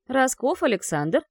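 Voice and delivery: icy teacher calling a name; cold tone. Acted. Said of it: "холодный тон магистра".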